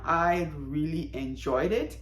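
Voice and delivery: ordinary delivery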